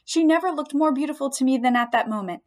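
plain reading voice

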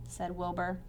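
plain reading voice

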